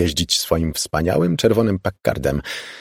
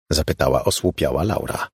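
usual speaking voice

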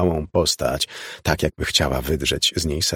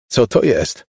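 raised, louder startled question; alarmed question; 4 semitones higher, 5 dB louder, slower than usual. A narrator's reading. Father asks Elizabeth what is out there, alarmed. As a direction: ask it loudly in alarm.

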